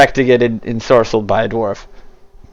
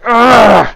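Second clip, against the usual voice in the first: long, louder, higher "ugh"; straining grunt; 10 semitones higher, 6 dB louder than usual. Acted. Character forced to dash, long straining grunt. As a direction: groan out loud as you're dragged along. Compelled character charges unwillingly.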